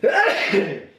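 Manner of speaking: sudden loud sneeze-cough; a real sneeze bursting out. Real, not acted. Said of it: "I'm gonna sneeze".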